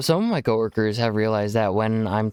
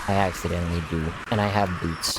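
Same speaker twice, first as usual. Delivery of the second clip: deadpan admission; 7 semitones lower, 3 dB quieter, faster than usual. Retold or imitated. Streamer answering coworkers about y'all, low deadpan. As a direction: lower deadpan confession. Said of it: "now I'm like".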